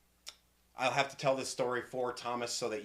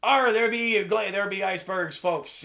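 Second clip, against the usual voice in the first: raised, blustering pirate voice; pirate captain; 8 semitones higher, 9 dB louder than usual. Acted. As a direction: announce it like a hammed-up sea captain. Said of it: "the captain looks over and he says".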